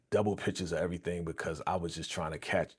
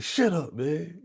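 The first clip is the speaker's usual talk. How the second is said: "Shut up" snapped higher, mock angry; mad retort; 8 semitones higher, slower than usual. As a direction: act out Junior's mad comeback. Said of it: "Junior got mad".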